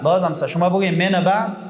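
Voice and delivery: usual speaking voice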